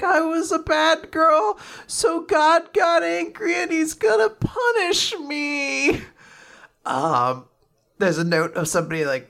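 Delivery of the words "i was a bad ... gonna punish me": high, drawn-out mock sobbing; sobbing little girl. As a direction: wail it like a tearful child, hammed up. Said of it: "And she's sobbing".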